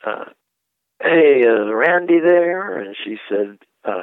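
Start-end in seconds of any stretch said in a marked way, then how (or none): 1.0-2.8 slow, drawn-out mock phone caller, pitched up; imitating deep-voiced Herman Griffin. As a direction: stretch it out as an impression of a big-voiced caller. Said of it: "he had a deep, deep voice".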